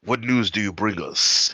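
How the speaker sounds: in-character greeting. Acted. Adventurer hailing an arriving figure for news, loud and theatrical. Calls out an in-game question.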